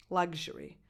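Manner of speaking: luxury said LUG-zhuh-ree, voiced gzh. Shown as a demonstration. Coach gives her own way of saying luxury with gzh. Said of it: "that's how I pronounce it".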